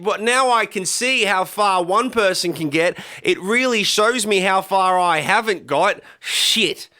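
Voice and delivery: high, slow put-on lament; hammed-up resentful Aussie moan; mock envious Aussie. Mocking envious Aussies looking up.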